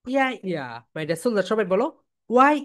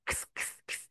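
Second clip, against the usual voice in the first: voiceless, very soft "খিস"; light whispered sound; 16 dB quieter than usual. Shown as a demonstration. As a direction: whisper it lightly, as if into someone's ear. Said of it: "হালকা, এইরকম". (কানে কানে বলার মতো ফিসফিস)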